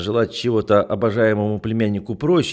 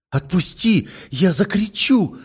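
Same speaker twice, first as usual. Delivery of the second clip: high, panicked cry; frightened plea; 10 semitones higher, 3 dB louder, slower than usual. Acted. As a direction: beg to be let go. Captive girl struggling, threatening scream.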